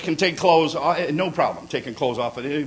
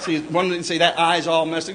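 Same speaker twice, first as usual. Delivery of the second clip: ordinary delivery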